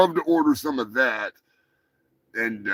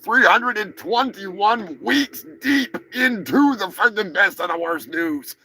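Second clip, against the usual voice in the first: announcer intro; 5 semitones higher than usual. Acted. Host opening the weekly news show, big announcer read. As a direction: lay out the week count like a show open.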